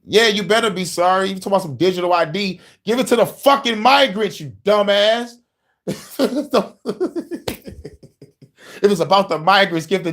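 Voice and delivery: half-laughing furious rant at the prime minister; heated rant. Real, not acted. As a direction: tell off the politician with laughing contempt.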